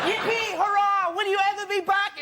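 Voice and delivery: high, sing-out cheering; mock jubilation. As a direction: cheer it high in overblown joy. Mock celebration of the donor.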